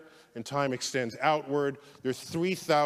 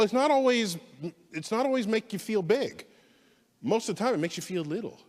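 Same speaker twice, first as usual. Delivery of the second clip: usual speaking voice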